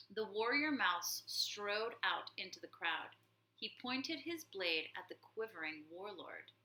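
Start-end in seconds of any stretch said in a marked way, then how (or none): none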